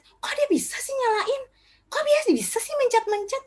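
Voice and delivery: high-pitched, amazed questioning. Retold or imitated. Parents marvelling at a toddler using a gadget, amazed.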